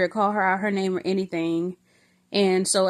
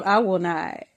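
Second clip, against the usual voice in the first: ordinary delivery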